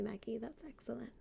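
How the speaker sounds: ordinary delivery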